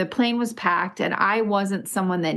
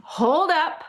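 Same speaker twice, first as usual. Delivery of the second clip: mock call; 8 semitones higher than usual. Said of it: "We've both been going".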